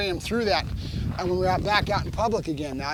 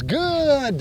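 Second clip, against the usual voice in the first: GOOD stretched, high then gliding down; drawn-out duration marker; 5 semitones higher than usual. Real, not acted. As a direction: stretch out the praise word "good". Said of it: "Duration marker".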